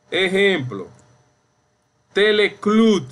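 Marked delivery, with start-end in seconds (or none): 2.1-3.0 teleclud as tele-CLUD, high and loud; stressed last syllable. Shown as a demonstration. Teacher gives students an example, teleclud stressed loudly on CLUD. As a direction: punch the CLUD at the end of teleclud. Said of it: "es la sílaba tónica".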